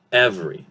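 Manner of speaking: "every" as EV-ree, middle e dropped; two-syllable form. Shown as a demonstration. Teacher repeating the reduced word, two-syllable "every" as EV-ree. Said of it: "two syllables".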